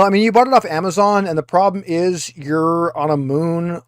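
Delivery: ordinary delivery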